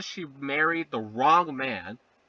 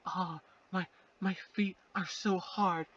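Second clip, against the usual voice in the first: mock sultry; 8 dB quieter than usual. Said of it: "Nightshade goes like".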